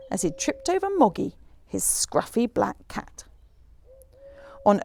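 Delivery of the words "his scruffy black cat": plain reading voice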